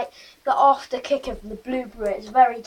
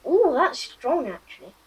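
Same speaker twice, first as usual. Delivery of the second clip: muffled by glass at mouth; words between sips; speaking into a glass; 5 semitones higher, 6 dB quieter than usual. Real, not acted. Seen 2: Boy holds small glass against lips throughout speech.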